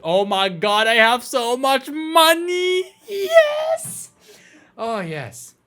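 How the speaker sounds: loud, climbing to a shriek; ecstatic disbelief. Pretend. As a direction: build to a high screamed celebration. Gleeful at amount of money.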